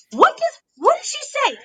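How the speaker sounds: squeaky rhetorical asking; high-pitched question. Real, not acted. Recalling what Liv said to Bianca, high-pitched. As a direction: voice leaps way up. Hyping up a retold taunt.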